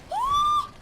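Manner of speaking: piercing high scream; long high, loud scream; loud shriek. Real, not acted. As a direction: shriek as if attacked. Hit in game.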